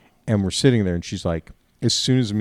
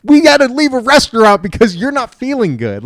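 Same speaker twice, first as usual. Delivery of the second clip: much higher and louder, fed-up protest; exasperated retort; 13 semitones higher, 10 dB louder than usual. Retold or imitated. Husband snapping back at his wife over the dessert, high and loud. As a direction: blurt it out in disbelief.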